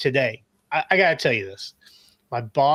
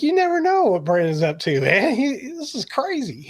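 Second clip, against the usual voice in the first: high put-on boss voice; imitated amazed boss; 9 semitones higher than usual. Boss marveling at what his employee gets up to, lightly imitated. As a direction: mimic a boss's amazement, rising high at the end. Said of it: "my boss is like".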